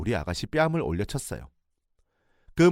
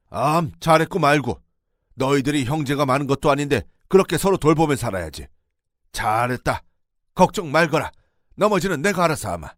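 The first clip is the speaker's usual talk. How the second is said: a father's approving reassurance; reassuring; 5 semitones higher, 7 dB louder than usual. Retold or imitated. Father-in-law reassures the tearful narrator. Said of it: "저를 위로했어요".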